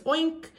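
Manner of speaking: high playful oink; a cheeky oink for kids; mock pig oink. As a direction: squeal it like a toy pig. Animal sound in farm rhyme.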